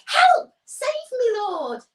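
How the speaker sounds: high, loud panicked yell; cry for help. Acted. Peter sinking in the waves, panicked cry for help. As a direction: shriek for rescue as you sink.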